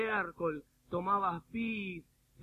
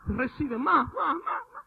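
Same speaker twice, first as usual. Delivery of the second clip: loud, high, straining repetition; imitated urgent prayer; 9 semitones higher, 11 dB louder, slower than usual. Preacher mimics pastor praying over woman, high mock shouting.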